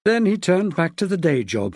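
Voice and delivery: usual speaking voice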